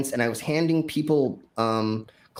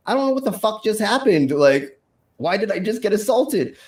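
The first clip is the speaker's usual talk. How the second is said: high-pitched retold incredulity; bewildered outrage; 7 semitones higher than usual. Performer answering worried friends backstage, bewildered outrage. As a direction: act out baffled indignation, voice pitched up.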